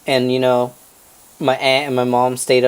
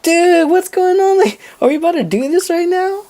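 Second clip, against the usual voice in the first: panicked disbelief. Retold or imitated. Passengers realizing the birth is starting, high panicked disbelief. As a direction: imitate a freaked-out squeal of questions.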